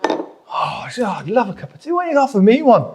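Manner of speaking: longing. Retold or imitated. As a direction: act out a pleading inner thought, mildly hammed-up. Acts out his unspoken longing for tea.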